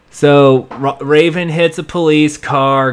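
normal talking voice

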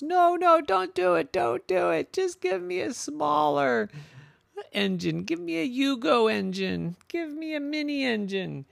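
frantic high-pitched begging; high pleading. Acted. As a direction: plead to keep some engine. Wolf begs the mechanic.

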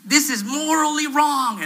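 high, loud, slow moral outrage; drawn-out mock indignation; fuming. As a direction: scold like an appalled woman, imitating her. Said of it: "fuming at me".

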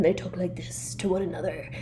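soft, deep mock-serious imitation. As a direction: drop into a hushed deep voice. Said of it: "in a very deep voice like this".

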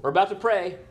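high, loud mock announcement of prayer; showy Pharisee. Pharisee making a show of praying in public, showy and loud. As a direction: announce it loudly for all to notice. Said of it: "what did the Pharisees do".

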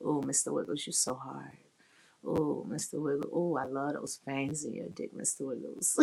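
hushed playful cooing; pet-name dirty talk. Retold or imitated. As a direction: coo to him like a pet. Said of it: "So I'm gonna talk".

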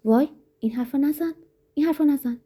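high-pitched, upset objection; acted woman's agitated rebuke; sharp protest. A narrator's reading. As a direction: protest shrilly while being shaken. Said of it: "به پرخاش گفت".

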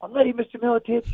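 a lifted, ready-to-go retold line. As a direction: say it up high like a keen young fighter. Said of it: "And I was ready to prove something".

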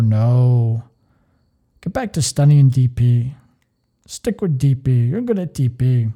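put-on a lightly done German accent, curt and bossy. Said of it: "Apologize for my German accent".

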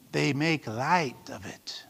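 slow, low and near-whispered; hushed answer. Real, not acted. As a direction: drop to a slow hush for weight. Hushed answer to own question.

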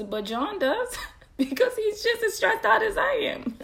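laughing through the words; giggling as she admits it. Real, not acted. Mom laughing to her listeners about her husband's stress, laughing.

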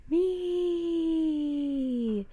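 high, loud, long-held creature cry; wild, shrill. A narrator's reading. Octopus speaks one word, wild and shrill. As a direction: stretch it out like a forest call. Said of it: "The sound was wild and shrill".

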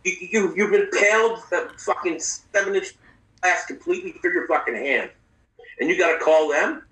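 high, disbelieving doctor rant; lightly voiced doctor scolding a stubborn patient; hint of an incredulous surgeon; exasperated doctor. Doctor berating patient, retold.

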